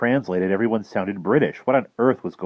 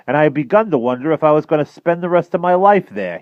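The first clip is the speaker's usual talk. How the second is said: higher, louder genial greeting; relieved gentleman; 9 semitones higher, 8 dB louder than usual. Acted. Townsend welcoming the travelers, genial and relieved. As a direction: sound glad to see them. Townsend explains his stranding.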